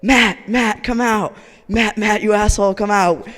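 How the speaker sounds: loud, high, insistent yelling; rowdy shouting. Retold or imitated. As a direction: imitate drunk guys demanding he come out. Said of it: "They kept shouting and banging on the door".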